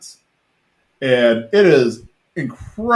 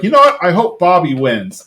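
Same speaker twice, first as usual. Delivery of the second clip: higher-pitched retold indignation; mock mad; 6 semitones higher, 4 dB louder than usual. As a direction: act out a mildly hammed-up angry retort. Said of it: "she's mad".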